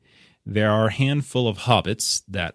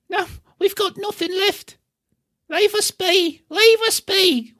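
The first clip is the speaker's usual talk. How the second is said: high, pleading hobbit voice, much higher; panicked hobbit; 3 dB louder than usual. Acted. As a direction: beg like a frightened little hobbit.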